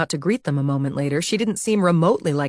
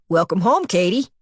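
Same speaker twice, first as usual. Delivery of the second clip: lightly voiced nasal New York doorman; nasal New York accent; 2 semitones higher, 3 dB louder than usual. A narrator's reading. Jolly doorman welcomes Katie home, nasal New York accent. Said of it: "in a nasal New York accent".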